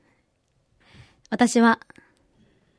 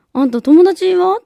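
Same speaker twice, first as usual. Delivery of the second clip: a hint of a puzzled mother; 5 semitones higher, 5 dB louder than usual. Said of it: "って言うから".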